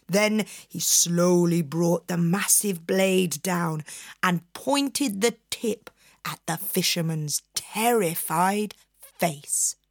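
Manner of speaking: usual speaking voice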